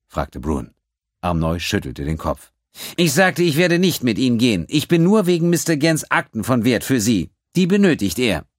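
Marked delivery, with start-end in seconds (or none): 3.0-8.5 high and loud, firm refusal; resolute. A narrator's reading. The accountant telling the pirate he will not come, resolute. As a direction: state your worth with no doubt. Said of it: "Amnois Stimme klang entschlossen".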